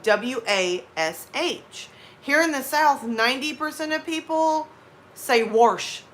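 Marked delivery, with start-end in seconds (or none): 0.0-1.9 spelled out slowly. Shown as a demonstration. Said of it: "wash is".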